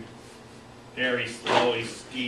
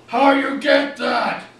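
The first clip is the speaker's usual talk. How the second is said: slow, high, very loud brute shout; ear-splitting yell; 13 semitones higher, 10 dB louder, slower than usual. A narrator's reading. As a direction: bellow it slowly, high and full out. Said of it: "ear-splittingly yelled".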